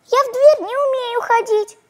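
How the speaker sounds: put-on very high, tiny girl's voice; thin high little-girl voice. A narrator's reading. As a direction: squeak it out like a small child. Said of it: "тоненьким-тоненьким голоском отвечает".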